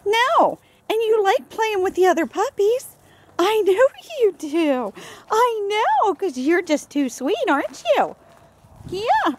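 high, sing-song cooing at a dog; baby talk to a puppy. Acted. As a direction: gush over the sweet puppy. Cooing at puppies.

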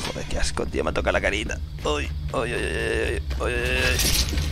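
hushed disgusted muttering; cursing under breath. Real, not acted. Snake touched face.

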